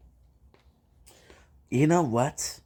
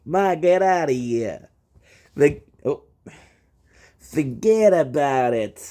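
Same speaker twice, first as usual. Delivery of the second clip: slow, louder Italian-American brush-off; mock Italian; 2 semitones higher, 5 dB louder than usual. Shown as a demonstration. Telling an Italian guest to forget about it, in a mock Italian-American accent. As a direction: wave it away like a New York Italian. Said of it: "if you're Italian".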